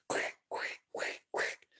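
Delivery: hurried voiceless whisper; urgent whisper. Retold or imitated. Whispering to the others to hurry up, urgent whisper. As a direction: hiss it fast under your breath.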